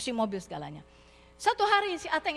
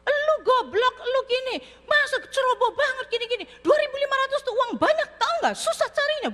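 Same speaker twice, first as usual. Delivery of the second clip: high, loud scolding father; father's email berating his son; angry father; 10 semitones higher, 4 dB louder than usual. Retold or imitated. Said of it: "email papanya Ateng marah-marah".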